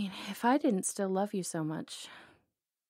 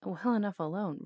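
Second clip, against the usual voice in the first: ordinary delivery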